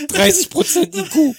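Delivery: laughing through it. Real, not acted. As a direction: giggly joke. Laughing jokes.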